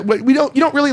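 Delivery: usual speaking voice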